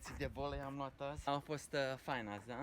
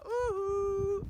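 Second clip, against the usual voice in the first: high, loud whoop; excited whoop; 7 dB louder than usual. Real, not acted. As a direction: whoop with delight during play. Cheering during ball play.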